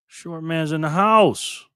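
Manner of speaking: slow, drawn-out hype-man announcement; emcee announcement. Real, not acted. Streamer welcoming a viewer onto the map, slow emcee announcement.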